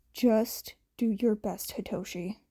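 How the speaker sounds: whispered, barely voiced; hushed self-talk before the exam; muttered under breath. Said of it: "Hitoshi mutters under his breath".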